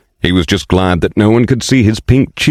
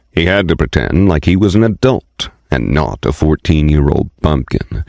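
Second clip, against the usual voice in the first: ordinary delivery, nothing marked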